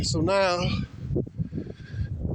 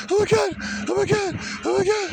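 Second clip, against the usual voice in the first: mock hyperventilating woman; faster than usual. Mocking the woman panicking at police, high hyperventilating. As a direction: gasp it out like you're panicking.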